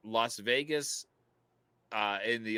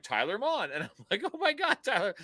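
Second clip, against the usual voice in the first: retold surprise; 10 semitones higher than usual. Retelling surprise meeting with Tyler.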